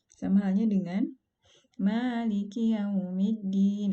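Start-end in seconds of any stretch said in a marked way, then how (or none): 1.7-3.9 Maliki Yaumiddin slow, vowels held too long; overlong mad demo. Shown as a demonstration. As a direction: draw out Maliki Yaumiddin as an error. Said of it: "itu dibaca menjadi terlalu panjang".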